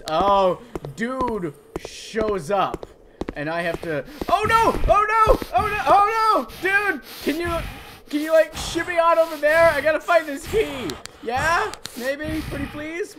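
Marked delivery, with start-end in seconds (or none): 4.3-7.0 high loud panicked cries; panicked yelps. Real, not acted. Caught by the monster, panicked yelps. 7.2-13.2 higher, wheedling pleading; coaxing plea. Pretend. Begging the monster to carry him, coaxing.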